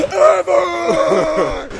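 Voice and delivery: high fan shout. Retold or imitated. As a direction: belt it out like an overexcited fan. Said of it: "and then he says".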